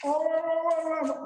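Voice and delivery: very high, sustained mimicked voice; mocking imitation. Landowner mimics the trespasser's reply, mocking imitation. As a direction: mimic the other man in a high put-on voice.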